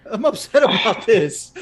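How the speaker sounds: half-laughing, half-whining complaint; upset but cracking up; laughing while upset. Real, not acted. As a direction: laugh through a mock-upset line.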